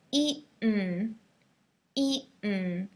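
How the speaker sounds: eaten with the T swallowed; eaten, T stopped in the throat; American eaten; glottal eaten. Shown as a demonstration. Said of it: "remember we talked about this word mountain".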